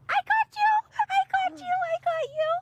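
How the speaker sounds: very high, quick delighted squeal; gotcha squeal. Real, not acted. Mother catching her toddler in a game, squealing high.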